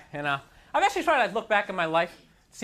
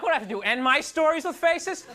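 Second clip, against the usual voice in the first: incredulous; 6 semitones higher than usual. Retold or imitated. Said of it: "I'm like".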